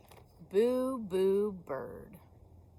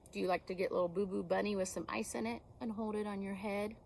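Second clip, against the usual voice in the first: usual speaking voice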